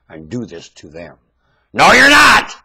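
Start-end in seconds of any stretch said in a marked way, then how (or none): 1.7-2.5 furious shout. Real, not acted. Ranter forbids the audience, full shout. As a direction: roar it high and loud.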